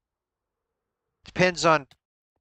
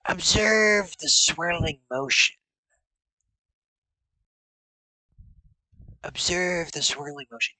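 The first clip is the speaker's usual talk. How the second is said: fortune teller; 3 semitones higher than usual. Pretend. Seer guides gaze into ball.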